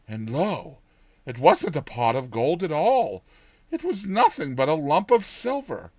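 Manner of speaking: ordinary delivery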